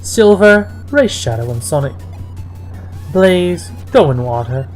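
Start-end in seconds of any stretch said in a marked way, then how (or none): none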